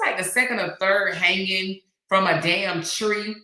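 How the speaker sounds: normal talking voice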